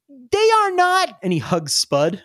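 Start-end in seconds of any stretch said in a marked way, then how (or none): 0.1-1.2 high, slow, drawn-out denial; protesting little kid. Acted. Little kid teased about alligators, high indignant protest. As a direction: stretch out a high childish objection. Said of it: "And he goes".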